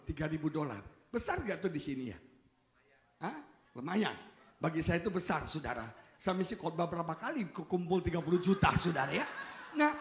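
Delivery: usual speaking voice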